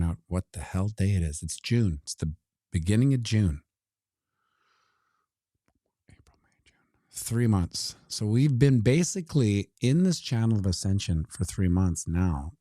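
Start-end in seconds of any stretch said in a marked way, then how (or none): 6.1-6.8 whispered count to himself. Real, not acted. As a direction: breathe it out under your breath.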